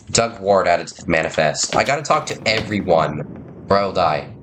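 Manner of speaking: usual speaking voice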